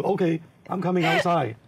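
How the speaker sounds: put-on a kid copying his friends' Chinese accent; answering his friends from inside, Chinese accent. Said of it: "so I try to have accent too".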